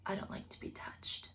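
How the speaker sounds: cold near-whisper; cool and calm. Retold or imitated. Stabler warning the cop off, cold whisper. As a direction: hush it, ice cold and unbothered. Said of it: "cool as a fucking cucumber".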